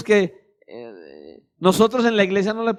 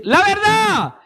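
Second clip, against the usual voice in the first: Holy Spirit prompting; 10 semitones higher, slower than usual. Acted. Holy Spirit urging the believer, high and insistent. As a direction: press the word like an inner voice.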